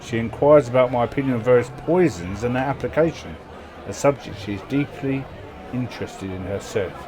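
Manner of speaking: loud, grand storyteller reading; event narration. Acted. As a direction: read the event like a saga. Reads game event text aloud.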